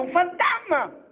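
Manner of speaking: high frightened cry; terrified shout. Acted. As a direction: shriek as if seeing a ghost.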